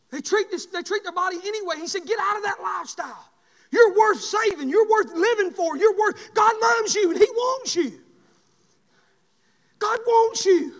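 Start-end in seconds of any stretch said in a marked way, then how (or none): 2.1-10.7 loud pleading preaching; fervent plea. Acted. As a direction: urge them to be saved.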